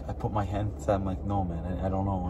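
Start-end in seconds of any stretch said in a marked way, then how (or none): 1.2-2.3 lower, softer fumbling; awkward and quiet. Retold or imitated. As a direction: trail off awkwardly. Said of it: "so awkward on the phone".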